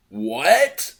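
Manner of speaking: loud laughing disbelief; incredulous what. Real, not acted. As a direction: blurt it in disbelief. Incredulous outburst.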